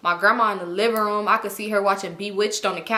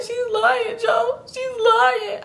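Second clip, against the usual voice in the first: high, whiny crying wail; mock crying; 13 semitones higher, much slower than usual. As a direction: wail it in disbelief, hammed-up sobbing. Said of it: "I'm crying".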